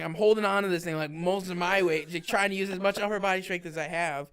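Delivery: loud, strained protest; retold exasperation. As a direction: act out arguing while bearing someone's weight. Acts straining to hold partner up.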